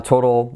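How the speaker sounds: native-sounding "total"; English-style "total". Shown as a demonstration. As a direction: soften the t of "total" into a flap. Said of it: "la diferencia de cómo suenan".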